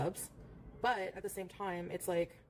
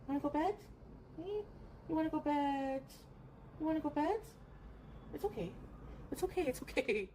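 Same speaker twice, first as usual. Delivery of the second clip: higher, cooing pet talk; 7 semitones higher than usual. Real, not acted. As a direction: coax the scared cat to bed. Baby talk to scared cat.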